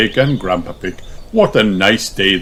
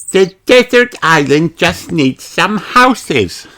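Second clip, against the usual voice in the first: high, bright little pig voice; high child voice; Peppa's voice; 8 semitones higher, 4 dB louder than usual. Acted. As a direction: play the excited piglet. Peppa's lines in a children's story.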